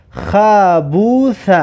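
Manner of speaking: slow, joined syllables with long vowels; drawn-out reading. Shown as a demonstration. Teacher reads an example word to learners, slow and drawn out. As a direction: stretch "خبوث" out in one slow run.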